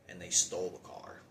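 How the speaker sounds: ordinary delivery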